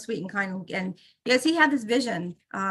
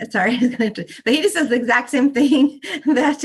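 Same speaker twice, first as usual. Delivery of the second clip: laughing apology; 3 semitones higher, 5 dB louder than usual. Real, not acted. Laughs at own stumble.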